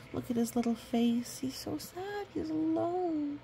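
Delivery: high, pitying coo; sympathetic. Acted. Showing the child the sad pup's face, a high pitying coo. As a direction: coo over the lonely pup.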